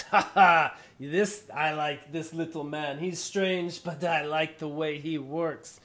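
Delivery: loud hearty giant; laughing giant. Acted. Giant approving of the little man, hearty and loud. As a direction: boom it out, delighted. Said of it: "He goes".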